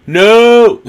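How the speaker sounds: sudden loud, high cry of protest; dismayed shout. Real, not acted. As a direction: yell it as it goes wrong. Reacting to a shock on screen.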